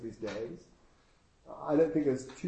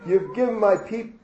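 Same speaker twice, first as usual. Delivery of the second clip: higher, louder hammed-up sob, breaking off mid-word; mock sobbing wife; 8 semitones higher, 9 dB louder than usual. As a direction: cry the complaint and cut it short.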